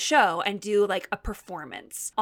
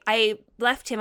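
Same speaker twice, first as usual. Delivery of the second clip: usual speaking voice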